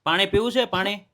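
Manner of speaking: calling loudly. Real, not acted. Interviewer asks hard-of-hearing grandfather about water, loudly. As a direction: raise your voice to reach his ears. ઓછું સાંભળે.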